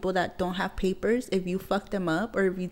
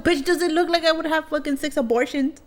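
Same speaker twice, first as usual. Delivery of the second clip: high-pitched indignant question; incredulous retort; 8 semitones higher than usual. Retold or imitated. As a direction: retell it in raised, disbelieving protest. Retold incredulous retort to accusation.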